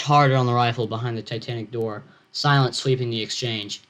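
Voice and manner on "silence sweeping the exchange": usual speaking voice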